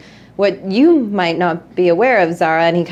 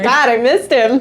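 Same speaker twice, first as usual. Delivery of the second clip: normal talking voice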